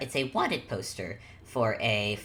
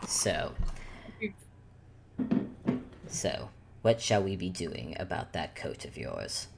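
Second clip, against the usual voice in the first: low, soft, measured character voice; Antler in character; 7 semitones lower, 7 dB quieter than usual. Acted. Antler pressing Ruby about her coat, low and quiet. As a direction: drop low and quiet for a pointed question.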